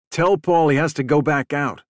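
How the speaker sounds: voice cracking, agitated. A narrator's reading. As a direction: urge it with a touch of distress. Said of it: "Andy's voice was cracking".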